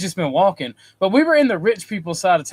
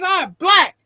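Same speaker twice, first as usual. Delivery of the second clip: higher, louder mock protest; indignant brother; 11 semitones higher, 6 dB louder than usual. Brother protesting to his brother after the scare, indignant. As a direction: declare it like the final word.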